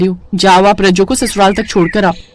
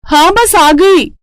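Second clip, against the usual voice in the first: much higher, drawn-out call back; hurried reply; 9 semitones higher, much slower than usual. Acted. Bride calling back to her husband, high and drawn-out. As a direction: sing out an answer from afar.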